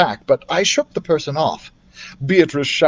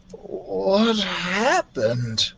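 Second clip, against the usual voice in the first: very slow, softer dazed question; 4 semitones higher, 5 dB quieter, much slower than usual. Acted. Daniel wakes after hypnosis, slow and dazed. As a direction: ask it drowsy and lost.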